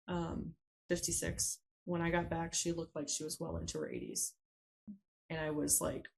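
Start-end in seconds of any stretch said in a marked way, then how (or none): none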